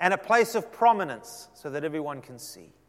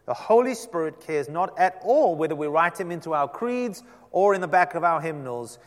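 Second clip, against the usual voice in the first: usual speaking voice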